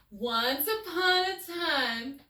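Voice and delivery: high, slow, loud fairy-tale start; storybook opening. Acted. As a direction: open the tale like reading to children.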